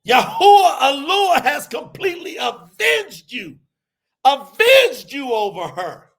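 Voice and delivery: loud, high, fiery sermon climax; full-force preaching. Acted. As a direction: proclaim it like a revival preacher.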